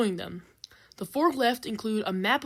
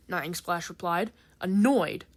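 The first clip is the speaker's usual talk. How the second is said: plain reading voice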